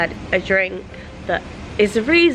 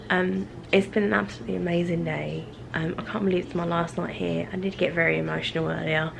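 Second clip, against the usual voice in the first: softer, low-key sign-off voice; hushed late-night talk; 5 semitones lower, 8 dB quieter than usual. Real, not acted. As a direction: keep it down, late at night in the hotel room.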